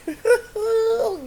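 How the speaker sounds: high, breathy burst of laughter; laughing at something she can't share; big laugh. Real, not acted. Vlogger cracks up at her own private joke, laughing.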